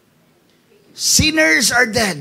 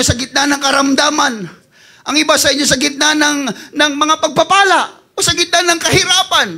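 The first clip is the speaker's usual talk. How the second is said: ordinary talk, nothing marked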